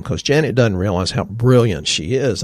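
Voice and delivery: normal talking voice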